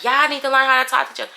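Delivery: quick, high-pitched retold yell; mock yelling. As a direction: imitate a mother fussing in a hurry. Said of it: "starting yelling at me like".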